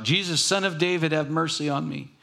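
high pleading cry; shouting plea. Retold or imitated. Blind man shouting to Jesus from roadside, high plea. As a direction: call out high for mercy. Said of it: "he began to shout".

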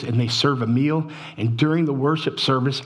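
ordinary talk

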